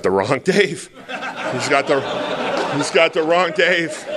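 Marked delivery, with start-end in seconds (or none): 1.5-4.0 higher, laughing inner voice; laughing panic. Retold or imitated. A sponsor recalls his thoughts, laughing and higher. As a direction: laugh at his own mix-up in his head. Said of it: "and my head goes".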